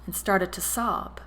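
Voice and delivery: ordinary delivery, nothing marked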